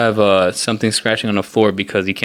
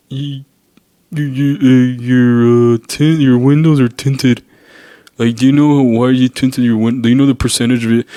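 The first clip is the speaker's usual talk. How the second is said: stammering, louder mock officer voice; mocking cop; 3 semitones higher, 3 dB louder than usual. Mocking the cop who asks about the tints, stammering mock voice. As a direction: ham up a fumbling cop.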